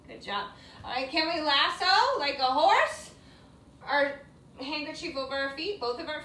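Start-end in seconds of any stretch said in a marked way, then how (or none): none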